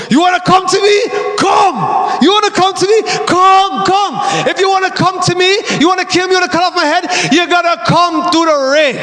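high, defiant Jamaican pastor's challenge; Jamaican accent. Retold or imitated. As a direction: preach the dare in a put-on Jamaican accent. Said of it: "without a Jamaican accent".

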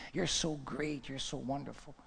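very soft, fawning praise; soft flattery. Acted. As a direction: murmur admiration, trailing away. Crowd flatters Gideon.

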